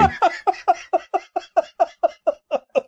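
high, repeating staccato laugh; stuttering laugh. Real, not acted. Laughing at co-host's elf story, high stuttering laugh. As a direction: laugh in a long high string.

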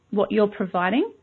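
usual speaking voice

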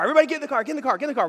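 high, urgent call to get in; hurrying call. Retold or imitated. Father rushing family into the car, high call.

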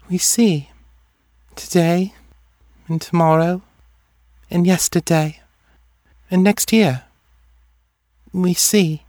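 high, detached voice with long pauses; dreamy seer. A narrator's reading. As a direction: let each phrase drift out separately, pitched high.

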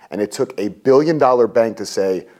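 ordinary delivery

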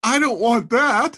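high, drawn-out protest; mock dismay. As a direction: wail a mock objection, slow and loud. Child's retold reaction to threat.

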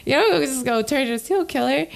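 fed up, pitch soaring; exasperated. Real, not acted. Indignant rant about killer.

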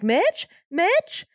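acted-out rising call. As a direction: call out for someone in the house, questioning. Acting Gail searching for Mitch.

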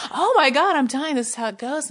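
high, loud retold panic; mock panic. Author retelling fear of dying, high mock panic. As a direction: cry out in hammed-up dread, easing off.

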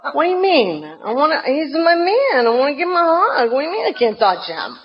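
high, pleading objection; mock outrage at the rules; high squeaky indignation with a laugh; incredulous protest. Objecting to no-touch rule.

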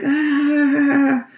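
very high, loud mock "ah"; the whole class reacting in disgust; disgusted class groan. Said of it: "And everybody's like".

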